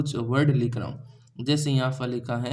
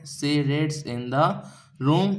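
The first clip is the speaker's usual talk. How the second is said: slow dictation; much slower than usual. Shown as a demonstration. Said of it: "क्या होगा dear student".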